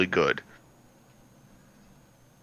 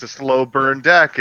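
ordinary talk, nothing marked

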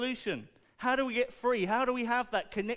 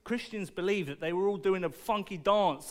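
usual speaking voice

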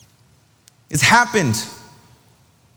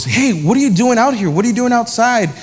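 higher-pitched, puzzled questioning; surprised dad; 6 semitones higher than usual. Retold or imitated. Dad finding his kid sitting outside, asking in surprise. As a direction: imitate a parent taken aback.

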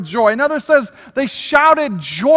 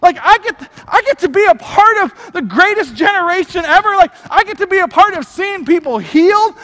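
excited; 6 semitones higher, 3 dB louder than usual. Pretend. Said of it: "you should be like".

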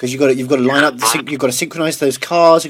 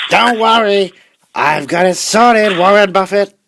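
[name] voice; 7 semitones higher, 3 dB louder than usual. Retold or imitated. [name] answers Buffett, acted.

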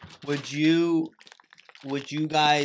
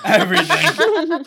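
squealed laugh; 9 dB louder, slower than usual. Real, not acted. Laughing squeal answering shaving question.

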